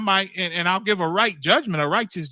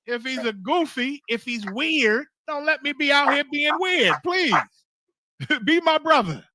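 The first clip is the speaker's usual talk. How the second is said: pleading; 8 semitones higher, 3 dB louder than usual. Acted. Preacher pleads to the congregation.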